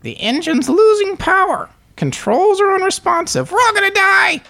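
very high panicked bird voice. Acted. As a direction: squawk it like a frantic toucan, very high. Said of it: "Burp voice".

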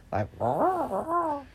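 mock growly creature noise; Wookiee sound. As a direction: ham up a Wookiee call. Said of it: "I'm more like a Wookiee".

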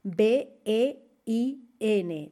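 B E I N spelled letter by letter, slowly; teacher spelling a German word aloud. Shown as a demonstration. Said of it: "Se escribe, ojo".

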